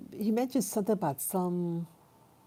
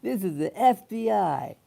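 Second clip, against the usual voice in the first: a hint of a puffed-up official announcing This is the F B I, a bit louder; mock official caller; 3 semitones higher, 5 dB louder than usual. Mocking the prank caller, a lightly voiced fake FBI agent. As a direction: lightly mimic a fake agent trying to sound important. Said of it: "He's trying to sound like he knew".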